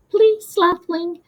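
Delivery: high, slow little plea; pleading. A narrator's reading. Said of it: "Flicker pleaded".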